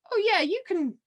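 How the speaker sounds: imitating an upbeat host, voice jumping high; eager yes. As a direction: pitch it up high like a delighted host picking someone. Host picks her from crowd.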